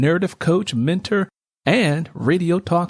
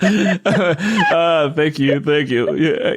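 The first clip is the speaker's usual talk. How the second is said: laughing; 2 semitones higher, much slower than usual. Real, not acted. Host laughs while thanking guest.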